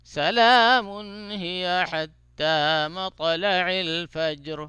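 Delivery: slow, drawn-out melodic tajweed chant; Quran recitation. Shown as a demonstration. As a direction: chant each phrase slowly with long vowels. Recites the surah.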